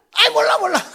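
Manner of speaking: put-on loud childish taunt, then run off; child's shout. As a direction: shout it like a cheeky four-year-old. Little son shouts and runs off.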